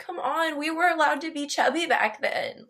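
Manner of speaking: high-pitched exasperated plea; mock protest. Podcaster protesting the film's beauty standard, mock exasperation.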